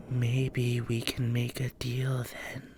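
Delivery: slow creepy whisper; tempting, ancient spirit voice; sly whisper. Acted. Spirit offers deal.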